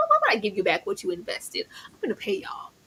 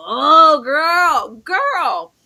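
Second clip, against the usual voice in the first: exasperated; 4 semitones higher, 8 dB louder, much slower than usual. Real, not acted. Exasperated outburst.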